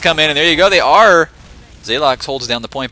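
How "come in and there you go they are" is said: loud, rising play-by-play shout; excited shout. Real, not acted. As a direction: yell it as the push lands. Calling out the big push.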